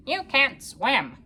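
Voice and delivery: slow, lightly quacky duck voice; a hint of a duck's quack. A narrator's reading. Duck taunting the bird, slow mild quack. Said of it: "quacked the duck".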